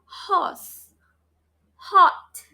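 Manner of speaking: "Horse" and "Hot" with a puff of air on H; airy H. Shown as a demonstration. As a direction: push air onto the hand with each H. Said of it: "feel the air from your mouth".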